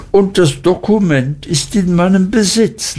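lifted Micawber voice declaiming his letter; Micawber reading aloud. Acted. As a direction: read it out like a proud preacher. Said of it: "las Mister Micawber wieder".

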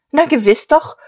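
high, loud triumphant cry. A narrator's reading.

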